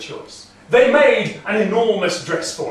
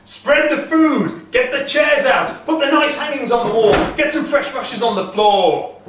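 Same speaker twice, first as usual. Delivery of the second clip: loud, high, eager orders; excited giant barking commands; giant's cry; 8 semitones higher, 5 dB louder than usual. Acted. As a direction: shout orders to the servants. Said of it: "he cries".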